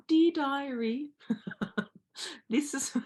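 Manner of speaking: mock diary voice. Coach mocking old-style journaling for her audience, hammed-up diary voice. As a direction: sing it out like a twee schoolgirl diary. Mocks the idea of diary writing.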